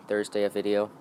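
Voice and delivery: ordinary talk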